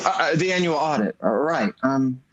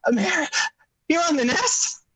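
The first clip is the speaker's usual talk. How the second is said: excited surprise; 10 semitones higher than usual. Acted. George realizes Mary is pregnant.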